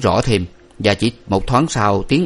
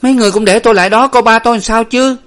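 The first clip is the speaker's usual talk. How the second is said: high, loud defiant protest; desperate, heated retort; shouting back; 13 semitones higher, 6 dB louder, faster than usual. A narrator's reading. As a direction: yell back, demanding to see your father. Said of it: "Nguyệt quát lại".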